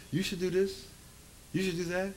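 hushed, soft nudge; little inner voice. Acted. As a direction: murmur it like a quiet thought. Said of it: "a little voice in your brain".

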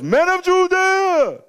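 high, drawn-out preacher's shout. Acted. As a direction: call it out so a whole crowd hears. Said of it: "then he raised his voice".

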